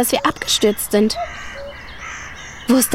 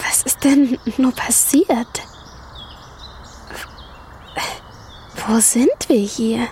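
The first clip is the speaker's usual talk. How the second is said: weak whisper; 5 dB quieter, slower than usual. Acted. Dragonfly wakes after the crash.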